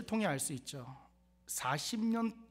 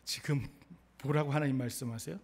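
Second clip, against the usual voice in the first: ordinary delivery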